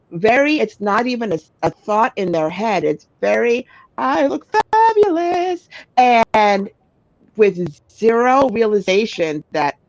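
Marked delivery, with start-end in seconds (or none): 4.0-5.7 high, slow, self-satisfied drawl; nonchalant diva. Retold or imitated. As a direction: preen like you own the room. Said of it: "very nonchalant".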